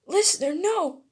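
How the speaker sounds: high, loud cry of horror; alarmed cry. Acted. Boy seeing his friend collapse, alarmed.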